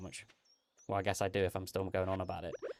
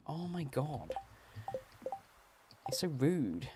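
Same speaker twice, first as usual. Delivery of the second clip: soft, disbelieving sigh of outrage; exasperated; 4 semitones higher, 7 dB quieter, slower than usual. Retold or imitated. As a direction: breathe it out in disbelief. Disbelief at a rude reply.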